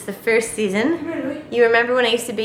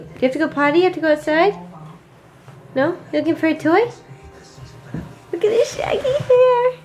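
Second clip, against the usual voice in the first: high doting voice; 6 semitones higher, faster than usual. Real, not acted. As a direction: coo at the puppy. Talking to the puppy.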